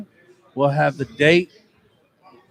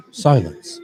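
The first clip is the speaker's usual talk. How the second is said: low hushed commentary; golf commentator; 7 semitones lower, 4 dB quieter than usual. Pretend. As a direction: whisper the tension of the green. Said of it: "commentating a golf round".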